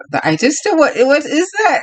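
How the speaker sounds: an imitated guest, completely lost; baffled surprise. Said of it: "And he was like".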